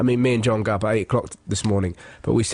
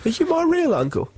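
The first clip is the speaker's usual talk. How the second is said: put-on much higher, small child asking. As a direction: ask it like a little kid. Child voiced asking uncle.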